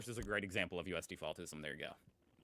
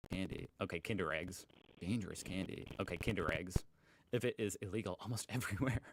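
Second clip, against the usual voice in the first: ordinary delivery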